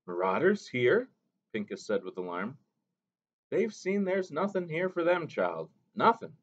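put-on a gentle old mother reminding the boy; Mo Mo Bay. A narrator's reading.

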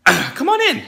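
very high chirpy greeting; overly sweet welcome. Acted. Host after apologizing, high chirpy welcome. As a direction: switch back to a high, friendly call.

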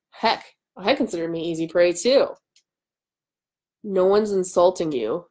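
plain reading voice

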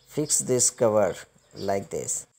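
ordinary talk

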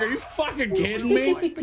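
high-pitched mock incredulity; exaggerated, rising exasperation; retold disbelief. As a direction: act out being flabbergasted. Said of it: "I'm like".

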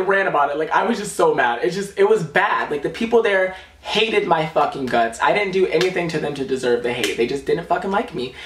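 usual speaking voice

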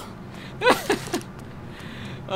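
airy high-pitched laughter; high breathy laugh. Real, not acted.

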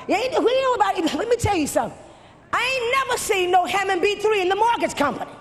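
preaching at full force. Acted. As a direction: hammer it home to the congregation, shouting.

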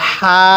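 long level হা on an audible breath; airy হা. Shown as a demonstration. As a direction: say হা with a light stream of air from the mouth. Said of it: "মুখ দিয়ে হালকা বাতাস বের করব".

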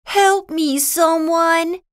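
high cry for help; desperate cry. A narrator's reading. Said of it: "he cried".

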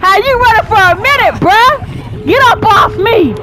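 high, loud protest; exasperated yell. Real, not acted. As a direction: holler in protest, pushing it away. Protesting at the animal.

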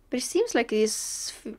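ordinary delivery

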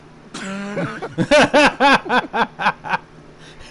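long real fit of giggling; sustained amused laughter; hearty laugh. Real, not acted. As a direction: crack up at a joke. Player amused by character's joke.

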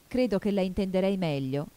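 ordinary delivery

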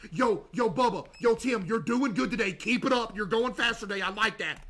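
upbeat mock manager praise; hype boss praise. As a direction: pump up the worker. Hyping up the employee.

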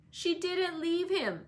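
high, drawn-out insistence; slow, petulant high denial; defiant contradiction. A narrator's reading. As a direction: contradict like a quarrelling boy. Children quarrel, boy contradicts flatly.

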